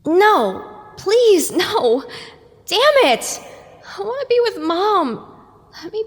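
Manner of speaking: desperate pleading. Acted. Sunrise begging to stay with Mom, anguished. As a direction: beg not to wake up.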